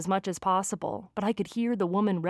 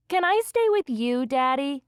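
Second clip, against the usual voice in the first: put-on high, louder child's plea; little girl; 7 semitones higher, 4 dB louder than usual. A narrator's reading. Daughter asking dad to let her stay, little-girl voice. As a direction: ask Daddy like an eager small girl.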